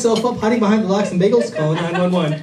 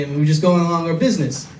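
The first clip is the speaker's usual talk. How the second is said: ordinary talk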